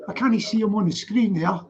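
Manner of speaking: normal talking voice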